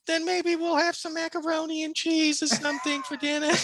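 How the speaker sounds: put-on high, sweet little old lady; sweet grandma voice. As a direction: coo it like a doting granny.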